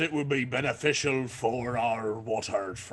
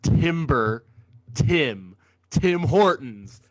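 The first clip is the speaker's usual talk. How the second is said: loud declaration; 7 dB louder, slower than usual. Acted. Declaring the name dramatically.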